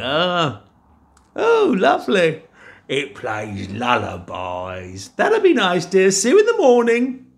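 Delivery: high, bored wife voice; giant's wife. Acted. Giant's wife humouring her husband in a high voice. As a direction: play the wife high and dismissive.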